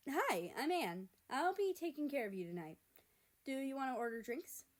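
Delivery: high, bright customer-service chirp; perky waitress. Acted. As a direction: greet them like a perky server. Perky waitress greets the boys.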